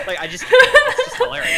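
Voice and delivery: very high, loud laughing words. Real, not acted. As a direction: gasp it out mid-laugh.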